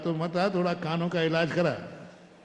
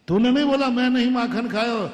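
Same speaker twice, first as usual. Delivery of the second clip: higher and louder, accusing; mother's sharp scolding; 6 semitones higher, 6 dB louder than usual. Acted. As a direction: challenge him like an angry mother. Mother scolds Kanhaiya in story. (गुस्से में डाँटती माँ)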